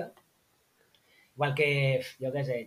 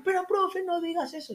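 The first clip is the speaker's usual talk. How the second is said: imitating outraged children, pitched up; indignant kids. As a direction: play a scandalized pupil begging the teacher. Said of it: "Indignados ahí".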